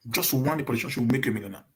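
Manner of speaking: ordinary delivery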